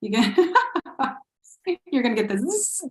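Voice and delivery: usual speaking voice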